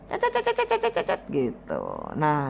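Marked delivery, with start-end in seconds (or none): 0.1-1.2 very high, rapid mocking yap of cet cet cet; high squeaky imitation of a scolding nag; mock nagging chatter. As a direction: mimic a nagging woman's endless chatter.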